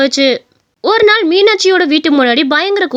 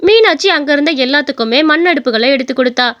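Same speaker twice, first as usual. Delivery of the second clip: ordinary delivery, nothing marked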